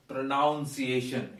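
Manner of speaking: 'pronunciation' wrongly said as 'pro-NOUN-ciation'; mispronunciation. Shown as a demonstration. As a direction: put the word 'noun' inside 'pronunciation'. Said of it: "It is not pronunciation".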